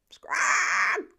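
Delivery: loud, harsh chicken screech; angry hen squawk. A narrator's reading.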